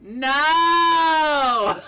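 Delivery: high, very loud cry of no; loud high wail; real shout. Real, not acted. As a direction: yell in dismay. Game over again.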